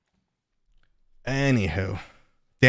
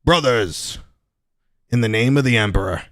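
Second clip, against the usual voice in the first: gruff, steady warrior intoning; Space Marine voice; 9 dB louder than usual. Acted. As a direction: proclaim it like a grim soldier. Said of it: "If I do that".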